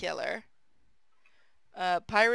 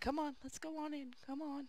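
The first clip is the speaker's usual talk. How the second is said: much softer, raised pitch coaxing; soft, higher coaxing call to the dogs; very quietly; 7 semitones higher, 13 dB quieter than usual. Retold or imitated. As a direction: hush the dogs inside without alarming anyone. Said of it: "very quietly".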